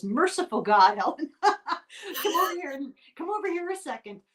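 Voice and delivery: high, excited call to come over; calling a friend across the room in amazement; urgent call. Retold or imitated. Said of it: "What she said was".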